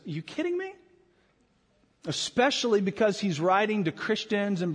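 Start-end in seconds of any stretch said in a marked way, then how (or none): 0.1-0.7 high, hushed disbelief; incredulous. Retold or imitated. Preacher voicing James, high and incredulous. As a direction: ask it like you can't believe your ears. James rebukes boastful planners.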